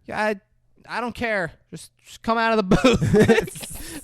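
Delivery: high-pitched imitation of a woman; Kelly. Girlfriend dismisses the confession, in a high mock voice. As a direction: pitch it way up, shrugging it off.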